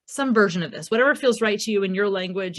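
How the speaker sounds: ordinary talk, nothing marked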